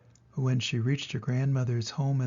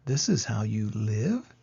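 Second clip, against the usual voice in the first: nervous; 4 semitones lower than usual. Acted. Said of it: "Sophie asked nervously".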